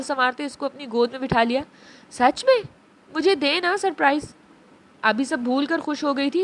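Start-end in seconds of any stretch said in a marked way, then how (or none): 2.1-4.3 put-on squealing high little girl; excited child. Abi begs Abeer for the surprise, excited. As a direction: bounce on the words with glee.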